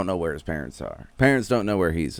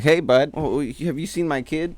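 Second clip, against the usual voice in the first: higher-pitched, casual dad asking around; mock friendly inquiry; 7 semitones higher than usual. Father asking a lion about his kid, mock friendly. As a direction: pipe up as a clueless friendly dad.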